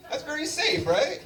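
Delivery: ordinary talk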